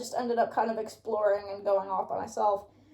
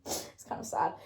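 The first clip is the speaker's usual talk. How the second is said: breathy whispered aside; whispering; 7 dB quieter than usual. Real, not acted. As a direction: whisper it to yourself. Self-pitying aside after laughing.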